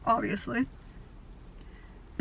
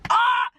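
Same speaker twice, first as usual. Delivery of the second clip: sudden high, loud "ah" of frustration; frustrated cry; 11 semitones higher, 6 dB louder than usual. Real, not acted. Vlogger upset that the TV couple split, frustrated cry. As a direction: burst out with an exasperated yelp.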